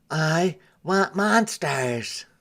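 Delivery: slow, lightly voiced little boy; a hint of a whiny boy. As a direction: lightly play the sulky boy. Alfred's demand voiced.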